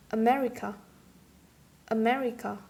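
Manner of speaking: "America" with ME, the third last syllable, stressed; stress on antepenultimate syllable. Shown as a demonstration. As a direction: hit ME in "America". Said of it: "antepenultimate or third last syllable".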